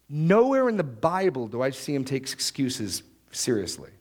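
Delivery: ordinary delivery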